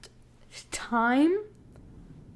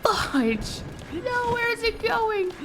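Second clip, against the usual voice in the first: sudden high yell; panicked shout; 13 semitones higher, 7 dB louder, much slower than usual. Real, not acted. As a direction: yelp in panic. Boat goes wrong way.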